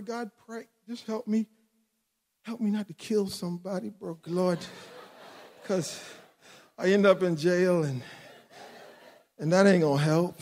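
soft, low retold plea; hushed, restrained prayer; private prayer. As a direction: pray quietly to keep yourself in check. Retelling his prayer about his daughter.

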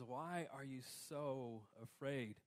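slow tender question; calm, gentle answer. Pretend. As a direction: answer softly like a calm father. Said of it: "his response is".

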